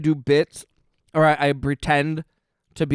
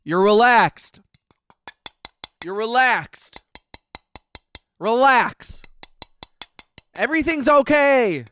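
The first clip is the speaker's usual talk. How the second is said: obnoxious ASMR; 7 semitones higher, slower than usual. Pretend. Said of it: "really obnoxious A S M R".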